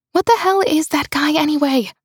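out of breath. Acted. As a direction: gasp it out while running. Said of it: "I panted".

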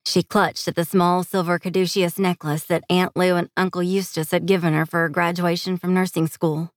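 plain reading voice

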